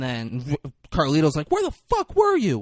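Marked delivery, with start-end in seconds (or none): 1.5-2.6 very high, loud mock outrage; indignant demand. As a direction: screech it at a late partner. Acted scolding of late accomplice.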